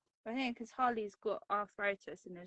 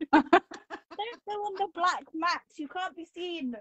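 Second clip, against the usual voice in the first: sweet-talking a pet, higher; 9 semitones higher, 10 dB louder than usual. Real, not acted. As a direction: coo at the dog, high and bright. Talking playfully to the dog.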